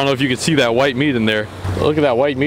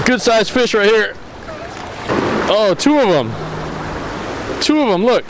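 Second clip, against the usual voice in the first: higher and eager; excited; 6 semitones higher than usual. Real, not acted. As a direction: call out the double catch. Two fish on the line.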